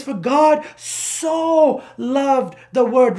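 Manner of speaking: "so" stretched out long and high; stress on SO. Shown as a demonstration. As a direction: hold the word "so" for emphasis. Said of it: "The emphasis on that word so".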